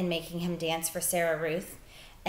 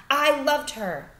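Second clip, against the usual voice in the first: insistent father; 7 semitones higher, 7 dB louder, slower than usual. Acted. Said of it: "said the father".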